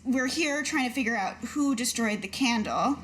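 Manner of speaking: ordinary delivery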